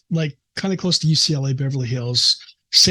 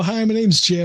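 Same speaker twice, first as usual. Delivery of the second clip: bright, upbeat Midwest-nice greeting, pitched up; a hint of the friendly aw-shucks guy; lightly played wholesome Midwesterner saying hello; golly gee shucks; 6 semitones higher than usual. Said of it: "doing the golly gee shucks".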